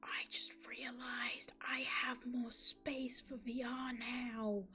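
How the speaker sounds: slow, breathy whisper of realisation; excited whisper. Real, not acted. Streamer realising there is room for VR, whispering. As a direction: whisper it slowly, as a dawning discovery.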